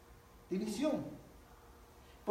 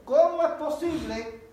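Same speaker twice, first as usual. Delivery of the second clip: loud, high, drawn-out incredulous question; acted disbelief; 5 semitones higher, 6 dB louder, much slower than usual. Listener reacts to gossip about Joel, acted loud disbelief. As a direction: imitate someone scandalised by gossip, slow and raised.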